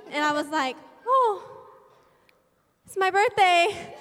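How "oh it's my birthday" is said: laughing retold reaction. Her own reaction retold.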